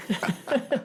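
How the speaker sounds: high-pitched breathy giggle; laughing at own joke. Real, not acted.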